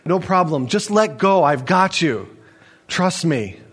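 ordinary talk

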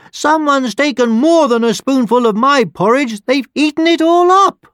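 very high, loud little bear squeal; baby bear crying out. A narrator's reading. Baby Bear finds his bowl empty, wailing. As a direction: cry it out in a tiny bear's high voice. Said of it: "the baby bear cried".

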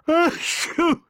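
high, loud acted sneeze; explosive storybook sneeze; king's wheezing sneeze; wheezy sneeze. A narrator's reading. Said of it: "wheezed the king".

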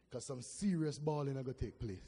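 ordinary delivery

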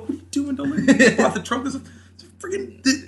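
high, loud panicked shout; panicked teen yelling. Retold or imitated. Teen boy panicking to friends in the car, high loud shout. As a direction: freak out about the stolen sign in the trunk.

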